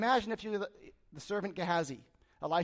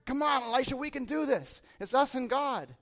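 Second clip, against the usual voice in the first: acted rallying cry, high and loud; rally your master, pitched up and eager; suddenly bold; 6 semitones higher, 4 dB louder than usual. Servant to Elisha once he sees God's army, acted boldness. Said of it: "now he's probably the boldest man".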